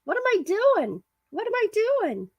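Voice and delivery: squeaky high confused voice; mock high-pitched fretting. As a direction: play it as a silly high fluster. Playful pretend self-questioning.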